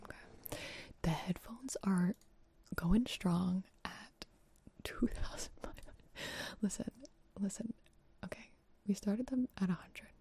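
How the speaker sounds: breathy close-mic whisper. Real, not acted. ASMR charity stream whisper.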